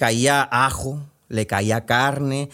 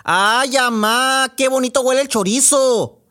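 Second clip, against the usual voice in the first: much higher, louder call to mom; sleep shouting girl; 11 semitones higher, 5 dB louder than usual. Acted. Sleeping girl calling to her mom about chorizo, loud. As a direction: yell it out in her sleep, dreamy and greedy. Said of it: "empezó a gritar".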